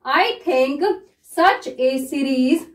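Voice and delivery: very slow, word by word; dictating a shorthand passage slowly; slow dictation. Shown as a demonstration. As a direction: read it slowly so students can follow. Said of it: "मैं इसको किस तरह से read कर रही हूँ".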